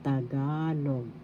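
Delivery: Tagalog slowly, leaning long on GA; stress on second syllable. Shown as a demonstration. Teacher shows where the accent falls, second syllable of Tagalog stressed. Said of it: "The accent is in the second syllable".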